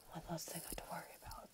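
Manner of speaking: secret relieved whisper; whispered aside. Retold or imitated. Teen vlogger whispering her relief to the camera, hushed. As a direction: breathe it out like a private thought. Private relief in a retold thought.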